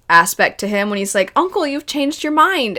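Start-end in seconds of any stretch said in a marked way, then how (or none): 1.4-2.8 sweet happy Zuko. Retold or imitated. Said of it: "the sweetest he's ever sounded".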